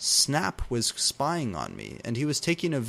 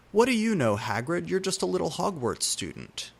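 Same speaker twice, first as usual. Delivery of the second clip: mocking; 7 semitones higher than usual. A narrator's reading. Mocking Hagrid in dialogue.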